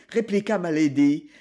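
usual speaking voice

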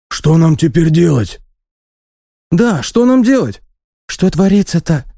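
high, anxious peasant voices; frightened villagers crying out; worried peasant. Acted. Panicked villagers question the elder.

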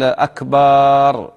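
long drawn "akbaaar" for أكبر; slow faulty أكبر ending in "baaar"; stretched baa, wrong. Shown as a demonstration. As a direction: hold the last vowel of أكبر very long. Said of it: "يمد باء أكبر".

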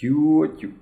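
high, pleading whine; plaintive boyish appeal; tearful cry. A narrator's reading. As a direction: call to her as if about to cry. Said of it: "плачучим голосом закричав Генка".

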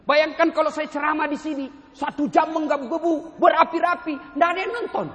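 loud, high, fired-up preaching; ceramah berapi-api. Shown as a demonstration. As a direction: build up the fervor phrase by phrase. Preaching at full force, mock fiery. (berceramah menggebu-gebu dengan suara lantang)

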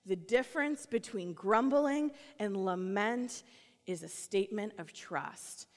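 ordinary talk, nothing marked

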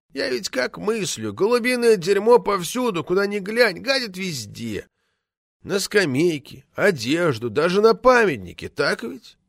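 high, musing character voice; folksy village writer. A narrator's reading. Village writer reasoning aloud to the committee, folksy and high. Voices Zastrekhin's rustic parable.

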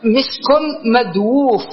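"مدووف" drawn out as madwūf after "مسك"; uncontracted form quoted. Shown as a demonstration. Teacher quoting the second exception, "مدووف" slowly as madwūf. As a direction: slowly sound the w in "مدووف", mad-wūūf. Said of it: "ويقولون".

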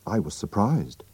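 ordinary delivery, nothing marked

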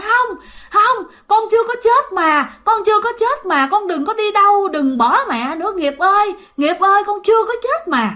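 high-pitched retold begging; desperate pleading. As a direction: act a mother begging her son to stay. Mother begs son to stay.

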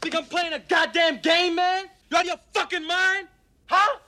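high, loud angry yelling; furious shout. Acted. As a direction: bark it at the whole bar. Cop shouting down bar patrons.

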